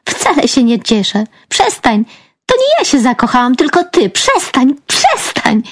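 high, excited squealed protests; playful protest. A narrator's reading. Tickled sister begging to be let go, playful high protest. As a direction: squirm away from tickling as you speak.

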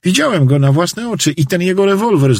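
high voice, recounting events to the sheriff; agitated janitor witness. Acted. (przejęty świadek, wysokim głosem)

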